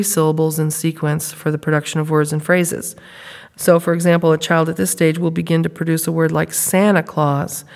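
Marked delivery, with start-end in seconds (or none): none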